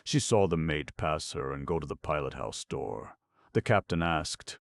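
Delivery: plain reading voice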